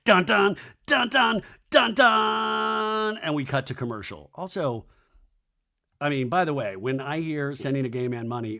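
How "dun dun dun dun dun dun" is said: mock soap-opera cliffhanger "dun dun"; high, loud suspense sting, last note held long; dramatic sting. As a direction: belt out a hammy dramatic music cue. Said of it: "really dramatic".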